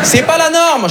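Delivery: indignation jouée. Acted. S'indigne pour rire.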